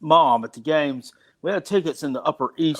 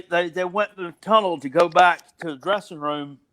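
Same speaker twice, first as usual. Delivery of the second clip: ordinary talk, nothing marked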